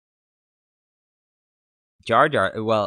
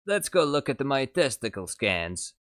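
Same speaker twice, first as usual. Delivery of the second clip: mock Watto; 5 semitones higher than usual. Joke Watto line.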